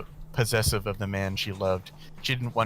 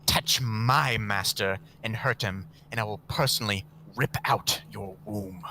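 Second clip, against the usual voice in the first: growled threat. Acted.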